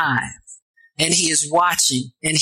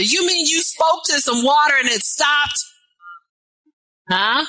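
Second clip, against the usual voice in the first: incredulous preaching shout; 7 semitones higher than usual. Real, not acted. Preacher marvels at scripture to congregation.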